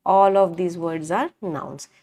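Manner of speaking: slow dictation. Shown as a demonstration. Teacher dictating a key sentence.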